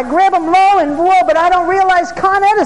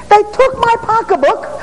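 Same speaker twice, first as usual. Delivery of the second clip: lady's excited story; 8 semitones higher than usual. Retold or imitated. Preacher voicing the mugged lady to the church, excited and high. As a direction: tell it like she can't believe it. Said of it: "she says".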